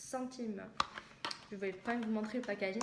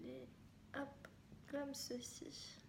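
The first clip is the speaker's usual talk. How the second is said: whisper; 3 semitones higher, 12 dB quieter, much slower than usual. Real, not acted. Showing the brush to camera.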